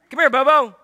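put-on calling a child.

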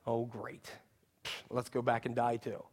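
very low, quiet sarcastic grumble; sarcasm. Acted. Thomas to the disciples, not to Jesus, muttered sarcasm. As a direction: mutter it drily under your voice. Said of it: "you hear the sarcasm in that".

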